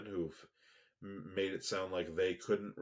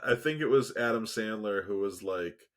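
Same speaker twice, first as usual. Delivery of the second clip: usual speaking voice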